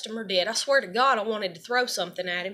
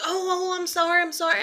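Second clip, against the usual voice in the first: mock apology; 8 semitones higher than usual. Coworker's fake apology quoted.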